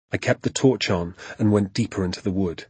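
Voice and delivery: ordinary delivery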